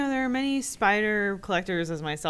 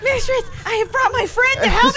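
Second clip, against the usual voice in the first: very high, loud character voice; shrieky mock-devoted minion; hammed-up servant; 9 dB louder than usual. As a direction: cry it out to a mistress. Acts out game character's line.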